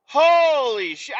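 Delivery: high, loud, slow disbelief; real astonished outburst; stunned exclamation. Real, not acted. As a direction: let the shock drag out. Reacting to road guy.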